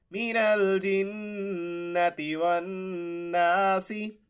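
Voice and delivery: slow chant ending वन्नासी with ी; connected recitation. Shown as a demonstration. Teacher modelling the linked reading. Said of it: "recites verse, connected form".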